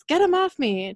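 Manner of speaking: narrated yell. A narrator's reading. Demon yells to the other demon for help, mild yell. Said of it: "She yells".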